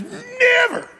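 very high, hammed-up outburst; mock vehement shout. Preacher playing Peter refusing, high mock shout. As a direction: blurt it as a high comic cry.

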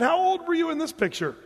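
high-pitched, curious retold question; eager curiosity. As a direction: ask the old man, voice lifted up high. Retold question to very old man.

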